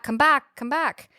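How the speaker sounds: high-pitched imitation of calling out; mild mock yell. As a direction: call someone back, half-hearted yell. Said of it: "yells for her to come back".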